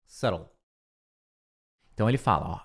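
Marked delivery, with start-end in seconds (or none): 0.0-0.5 low, soft "Subtle" as SUH-rol; correct pronunciation of subtle. Shown as a demonstration. Teacher confirming the right form, "Subtle" as SUH-rol. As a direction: close with a soft "Subtle", B silent. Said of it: "com B mudo no meio".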